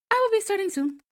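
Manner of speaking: very high, playful squeak; high little-shark voice. Acted. Acts out little shark on screen.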